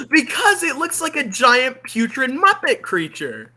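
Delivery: ordinary delivery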